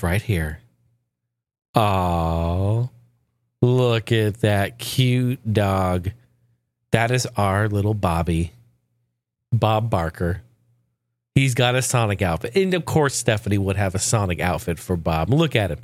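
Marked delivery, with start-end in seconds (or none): 0.0-10.4 cooing at dog. Real, not acted. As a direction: coo over the dog photo slowly. Cooing over the dog picture.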